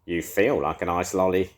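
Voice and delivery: cackling witch. A narrator's reading. Edna gloating at the beast in a cackling witch voice. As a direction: cackle it at him like a wicked witch. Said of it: "cackled Edna".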